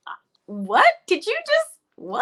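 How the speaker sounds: high squeaky retold what; replaying the shock of the offer; stunned disbelief. Guest replays hearing the anthem offer in disbelief. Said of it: "I said".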